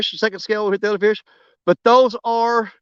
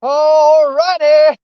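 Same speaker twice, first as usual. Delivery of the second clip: excited; 4 semitones higher, 6 dB louder, much slower than usual. Real, not acted. Fish landed, celebrating.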